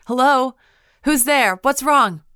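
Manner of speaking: high, loud calling out; shouting. A narrator's reading. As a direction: call into the dark. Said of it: "I crept toward the sound and shouted".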